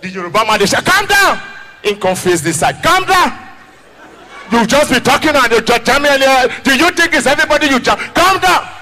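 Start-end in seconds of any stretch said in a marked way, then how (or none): 0.8-1.4 angry Yoruba man yelling at the driver; shouting calm down. Retold or imitated. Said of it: "In the shout". 2.8-8.7 angry shout. Retold or imitated. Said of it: "In the shout".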